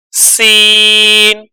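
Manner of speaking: slow, stretched letter name; drawn-out letter name. Shown as a demonstration. Teacher drawing out the letter name for students. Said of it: "ہجے کرتے جائیں گے".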